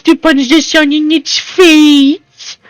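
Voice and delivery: high, put-on voice with a stretched ending; approving character voice. Character praises good boy Scott for not fighting the sun, high put-on approval. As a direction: praise him in a high put-on voice, drawing out the end. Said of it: "he would be saying that like that".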